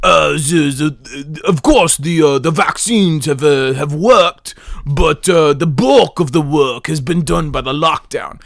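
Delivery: bumbling, stammering British politician; Boris Johnson. Shown as a demonstration. Host mocking a prime minister's statement, in a halting British accent. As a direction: stumble through it in a mock British accent. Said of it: "the Boris Johnson".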